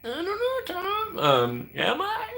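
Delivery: hammed-up tiny falsetto reply; squeaky timid self-imitation. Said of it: "And I was like".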